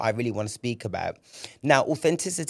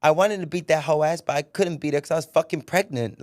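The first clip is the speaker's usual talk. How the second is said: higher, louder imitation of an outspoken witness; raised, unfiltered quoted voice; 6 semitones higher, 5 dB louder than usual. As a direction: mimic her brazen courtroom cussing, pitched up. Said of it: "that's how she was speaking".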